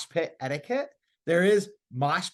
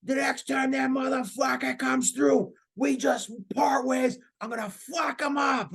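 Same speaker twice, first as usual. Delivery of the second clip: put-on Boston accent; 7 semitones higher than usual. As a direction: do the guy from Southie in a thick Boston accent. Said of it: "He had this crazy accent".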